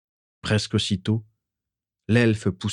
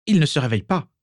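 higher, stiff and disapproving; haughty mother criticising the healer, pinched; prim, pinched remark; 7 semitones higher, faster than usual. A narrator's reading. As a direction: sniff at the healer's failure. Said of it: "d'un air pincé".

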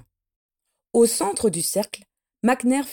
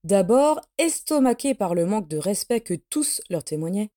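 ordinary delivery, nothing marked

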